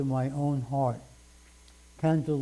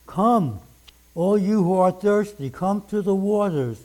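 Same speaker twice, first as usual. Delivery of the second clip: louder, raised proclaiming of the verse; fervent scripture reading; 3 semitones higher, 5 dB louder than usual. Acted. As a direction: call out the invitation like a preacher at full force. Preacher reads scripture aloud.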